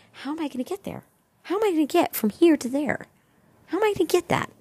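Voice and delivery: high, climbing worried questions; anxious inner voice. Acted. Anxious imagined self-questioning.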